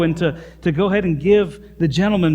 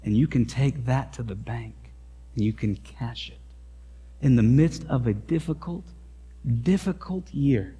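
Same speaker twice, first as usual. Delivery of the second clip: quiet sermon close; 3 semitones lower, 6 dB quieter than usual. Acted. Soft sermon application.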